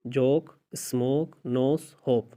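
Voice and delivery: "जोक, स्मोक" said slowly with a clear long o; slow teacher demo of the o sound; word list demo. Shown as a demonstration. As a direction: spell out "नोस, होप" slowly, stretching the o. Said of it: "इन चारों में आप देख सकते हैं".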